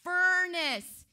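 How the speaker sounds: loud warning building to a boom; emphatic royal threat. Acted. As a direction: punch it home as the threatening king. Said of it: "He's making sure you understand".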